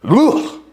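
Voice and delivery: loud, high, disgusted ugh. Retold or imitated. Speaker mocks the wife's revulsion with a loud high ugh. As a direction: spit out a revolted grunt.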